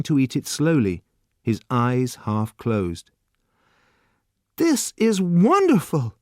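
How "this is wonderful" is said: slow, much higher rapturous praise; crying out in delight. A narrator's reading. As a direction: savour every word. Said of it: "he cried".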